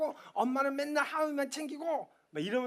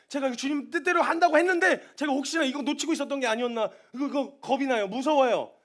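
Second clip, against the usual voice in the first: rising, louder anxious outburst; fearful pleading; 4 semitones higher, 6 dB louder than usual. Retold or imitated. A believer confessing fear to the congregation, getting louder and anxious. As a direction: voice climbs in panic.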